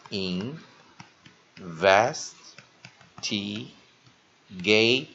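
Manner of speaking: "investi-, gate" in slow, separate pieces with long gaps; syllable by syllable. Shown as a demonstration. Teacher repeating for students, "investi-, gate" syllable by syllable. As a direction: sound out "investi-, gate" one syllable at a time. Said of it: "好，再一遍".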